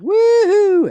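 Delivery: high, loud hammed-up whoop; rowdy whoop. Rowdy storm chasers whooping at the tornado. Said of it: "And they're like".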